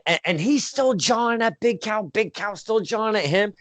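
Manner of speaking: ordinary talk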